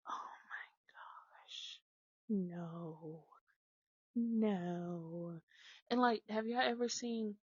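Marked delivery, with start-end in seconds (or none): 0.1-5.4 unvoiced, much softer, slow; whispered panic. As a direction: whisper it, horrified. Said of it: "unvoiced whisper".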